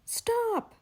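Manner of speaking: a frantic high yell; scream. Acted. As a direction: shout it in sudden alarm. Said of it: "she screamed".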